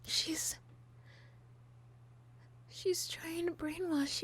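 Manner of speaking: high, intense, possessive pleading; unhinged villain. Acted. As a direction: obsessive lover insisting.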